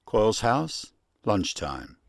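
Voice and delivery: ordinary delivery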